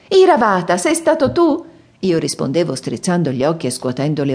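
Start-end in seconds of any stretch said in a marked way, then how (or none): 0.1-1.6 high, eager, loud asking; bursting with wonder as she asks; excited question. A narrator's reading. Princess asks her elephant, high and amazed.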